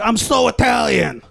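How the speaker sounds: high, slow mock Jersey boast; hammed-up proud Italian American; New Jersey Italian American. Jersey Italian American boasting of heritage, hammed-up pride. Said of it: "I love how they're like".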